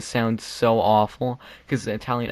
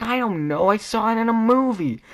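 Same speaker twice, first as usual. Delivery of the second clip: high-pitched, hammed-up flustered excuse; exaggerated helpless squeal of protest; mock desperate defence; 11 semitones higher, 4 dB louder than usual. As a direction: blurt it out like a cornered student. Acts out himself defending in class.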